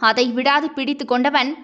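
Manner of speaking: ordinary delivery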